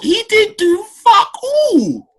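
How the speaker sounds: high, loud, slow laughing outburst. Real, not acted. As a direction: laugh it out in disbelief. Mocking Henman, laughing.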